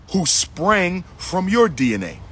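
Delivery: ordinary talk, nothing marked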